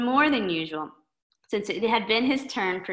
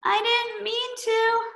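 high, slow, sing-song cartoon kid voice; a clumsy kid pleading it was an accident; high, slow kid apology; dismayed Klutzy Boy; 8 semitones higher, 4 dB louder than usual.

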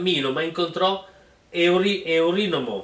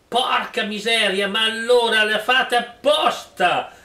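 cursing; 6 semitones higher, 4 dB louder than usual. Acted. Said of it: "E Lato impracò".

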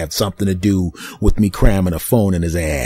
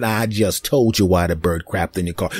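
normal talking voice

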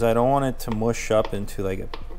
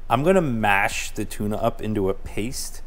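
ordinary talk, nothing marked

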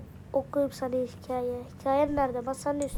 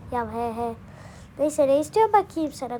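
normal talking voice

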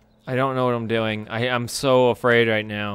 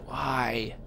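usual speaking voice

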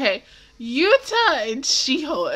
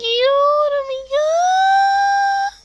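drawn-out gush; 13 semitones higher, much slower than usual. Real, not acted. Gushing over baby.

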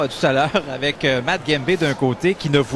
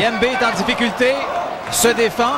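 excited; 6 semitones higher than usual. Real, not acted. Commentator reacts to heavy punches.

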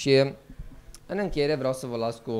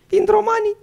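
high, loud mocking cry; high loud put-on voice; mock announcement; 7 dB louder than usual. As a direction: call out the Romans arriving in a silly voice. Dacians mocking Romans arriving.

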